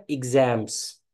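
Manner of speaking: "एग्जाम्स" opening with a short IG; correct pronunciation. Shown as a demonstration. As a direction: say "एग्जाम्स" as ig-ZAMS. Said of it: "याद रखिएगा". ("एग्जाम्स" को इग्ज़ैम्स बोलें)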